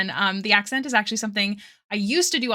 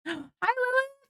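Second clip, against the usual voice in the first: excited gasp greeting; 4 dB quieter, slower than usual. Real, not acted. Gasps, greets arriving viewer.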